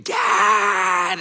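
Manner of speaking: loud high yell for dad; screaming for dad. Retold or imitated. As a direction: shout it like a scared child calling. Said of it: "I hear Luca screaming".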